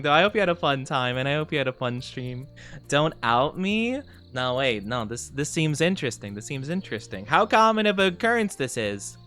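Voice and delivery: ordinary talk, nothing marked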